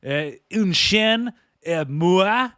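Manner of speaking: hammed-up French Canadian hockey player; French Canadian accent. Joking about a player, hammed-up French Canadian accent. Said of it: "put on the French Canadian accent".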